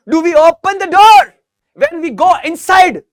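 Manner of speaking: very slow, loud, every word spaced out; slow model answer. Shown as a demonstration. Teacher drilling the class on a question, slow and loud. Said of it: "teacher models the answer sentence".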